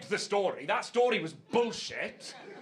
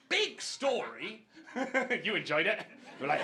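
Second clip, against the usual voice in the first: ordinary talk, nothing marked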